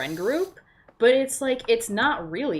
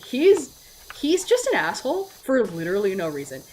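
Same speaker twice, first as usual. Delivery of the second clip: usual speaking voice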